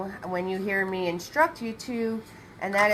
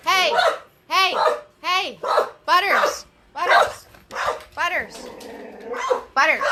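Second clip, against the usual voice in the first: loud, higher yells; yelling at the dog; 7 semitones higher, 6 dB louder than usual. Real, not acted. Owner yelling at her barking dog Butters, loud. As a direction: shout the dog down to stop him. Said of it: "I have to yell at the dog".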